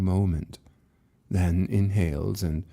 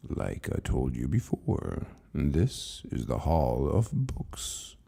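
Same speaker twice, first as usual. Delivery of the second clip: low, slow and softer keeper voice; an ancient librarian speaking with patient calm; calm old keeper; 6 semitones lower, 5 dB quieter, slower than usual. Acted. Keeper calmly answers frightened Lucas, low and slow.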